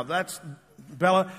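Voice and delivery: normal talking voice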